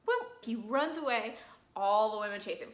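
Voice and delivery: ordinary delivery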